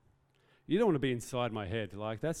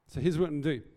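blurted out; 3 semitones higher, 6 dB louder, faster than usual. Real, not acted. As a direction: jump in a bit too loud.

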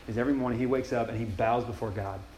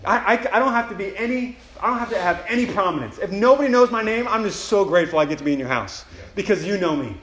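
louder, overjoyed thanks to God; excited thanks; 9 semitones higher, 7 dB louder than usual. Acted. Believer thanking God for a place in his house, overjoyed. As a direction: gush with grateful excitement. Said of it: "I feel so excited".

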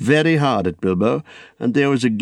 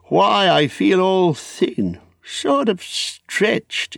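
put-on high, stretched-out old hobbit; weary Bilbo; 7 semitones higher than usual. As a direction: complain wearily in a high old voice. Bilbo complains of feeling thin.

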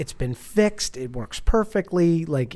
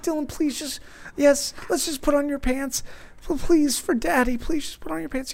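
high, softened begging voice; desperate pleading; 10 semitones higher, 3 dB quieter than usual. Retold or imitated. Dad begging his son to get dressed, desperate pleading. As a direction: coax a child at wit's end, acted.

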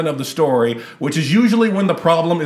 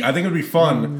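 usual speaking voice

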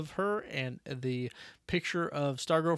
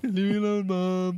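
put-on higher, louder whiny teen; whiny teenager; 6 semitones higher, 4 dB louder than usual. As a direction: whine it at your mom. Voicing whiny teen to mom.

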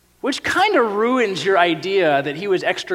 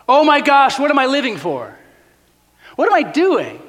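higher, louder midlife panic; hammed-up existential dread; mock panic; 6 semitones higher, 4 dB louder than usual. Midlife crisis inner voice.

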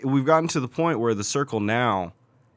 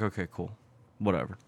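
mumbled shrug; 8 semitones lower, 13 dB quieter than usual. Retold or imitated. Shrugging off bad loot.

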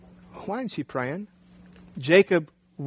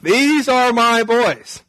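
incredulous husband; 6 semitones higher, 3 dB louder, slower than usual. Retold or imitated. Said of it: "He's like".

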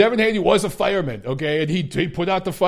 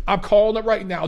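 ordinary talk

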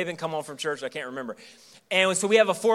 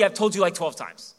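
normal talking voice

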